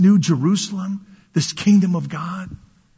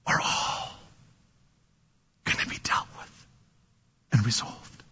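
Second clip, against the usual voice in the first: whispered promise; 10 semitones lower, 12 dB quieter than usual. Acted. Preacher hushes a promise.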